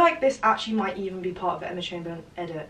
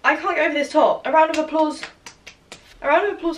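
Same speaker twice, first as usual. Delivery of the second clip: excited rave; 7 semitones higher, 7 dB louder, faster than usual. Real, not acted. Raving about the Gracie top.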